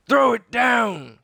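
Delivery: high, loud, slow order; captain demanding the medallion; commanding cry. A narrator's reading. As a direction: bellow the order. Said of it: "cried Captain Bones".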